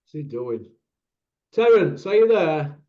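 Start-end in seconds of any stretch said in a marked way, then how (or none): 1.5-2.8 loud, high call down the line. Real, not acted. Host calls for his silent co-host Terence, calling out. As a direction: call out for someone who isn't answering.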